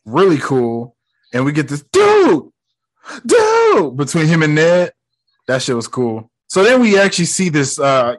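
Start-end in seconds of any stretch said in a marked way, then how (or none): 1.9-4.0 high, loud drawn-out call; two buddies yelping at each other; hammed-up high yelp; mock exclamation. Imitating exchange between friends.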